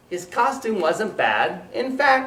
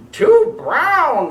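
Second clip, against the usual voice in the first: high, loud squawk; squawking rooster; 13 semitones higher, 8 dB louder than usual. Acted. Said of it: "squawked Rooster".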